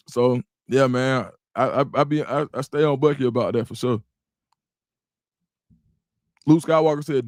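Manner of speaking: ordinary talk, nothing marked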